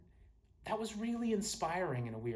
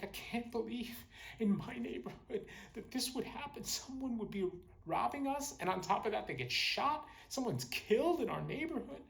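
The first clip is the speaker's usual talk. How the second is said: high-pitched, upset neighbor imitation; 8 semitones higher than usual. Neighbors giving statements about a killing, mock upset. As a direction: act the distressed neighbor, voice pitched well up. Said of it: "Most people were very upset".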